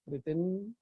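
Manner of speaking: soft-spoken breathing instructions; guided meditation. Real, not acted. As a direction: lead them gently through the breath. Leads a guided chakra meditation.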